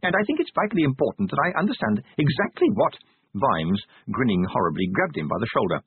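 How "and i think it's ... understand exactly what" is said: high-pitched, unruffled clerk; mild-mannered bureaucrat, pitched high; calm little official. A narrator's reading. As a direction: explain evenly in a high voice. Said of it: "said A E Passimo calmly".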